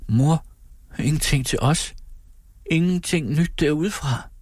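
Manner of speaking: put-on high, hopeful questioning child's voice; pleading daughter. A narrator's reading. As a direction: ask Mother in a high, anxious plea. Child anxiously questions mother about news. (bedende datterstemme, højt og spørgende)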